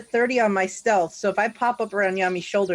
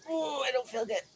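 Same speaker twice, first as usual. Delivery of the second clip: weak, queasy and a bit softer; sick voice; 3 semitones higher, 10 dB quieter, much faster than usual. Acted. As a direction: groan it out like you might throw up. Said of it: "If you're gonna vomit".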